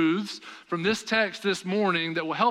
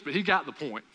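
usual speaking voice

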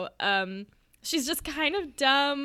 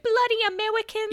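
higher, faster put-on Cockney with baby-talk Rs; fake Cockney accent; 11 semitones higher, faster than usual. Describing a movie character's bad fake Cockney accent. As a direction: mimic a clumsy American faking a Cockney accent. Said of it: "horrible, horrible British accent to try to fit in".

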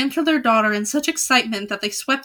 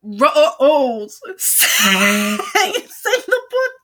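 high, breaking with laughter; laughing too hard to sing; laughing while reading; 3 semitones higher, 4 dB louder, much slower than usual. Real, not acted. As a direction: giggle through the words. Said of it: "this got me cackling".

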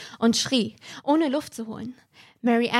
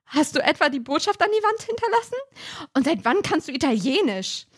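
much higher and faster; frightened questioning; 5 semitones higher than usual. Acted. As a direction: panicky rush of questions. Peter verängstigt fragt weiter. (verängstigt, hoch und schnell)

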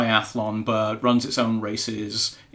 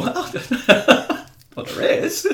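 laughing; much slower than usual. Real, not acted. Laughing at her opening the gel.

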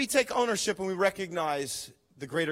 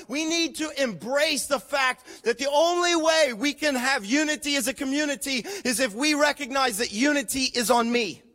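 usual speaking voice

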